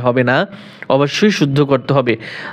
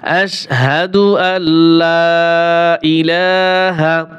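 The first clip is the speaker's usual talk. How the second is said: slow Quranic recitation; much slower than usual. Shown as a demonstration.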